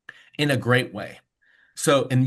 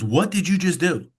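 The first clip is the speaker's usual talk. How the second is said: higher-pitched mock-panicked question; retold panic; 5 semitones higher than usual. Team member phoning the boss about swamped lines, mildly panicked. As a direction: imitate a panicked caller, mildly. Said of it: "she called me panicking".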